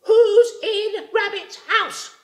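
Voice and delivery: high, slow rabbit call at the door. A narrator's reading. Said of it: "And she called again".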